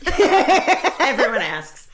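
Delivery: stretched slowly through laughter; laughing. Real, not acted. Comic laughing at her own weight joke. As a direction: laugh it out, high and loud.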